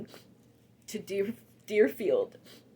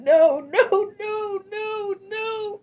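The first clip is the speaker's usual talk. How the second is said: sobbing no, pitched high; wailing denial through tears; crying; 8 semitones higher, 6 dB louder, much slower than usual. Retold or imitated. Reliving the moment she heard Nana died, crying.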